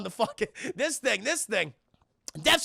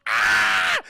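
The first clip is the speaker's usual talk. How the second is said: scream; 13 semitones lower, 8 dB louder than usual. Retold or imitated. Ripley screams.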